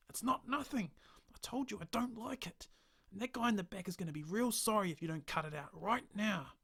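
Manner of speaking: high, loud angry outburst; furious. Acted. Stranger furiously warning the bartender to stop the hand. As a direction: rage at the bartender, warning him.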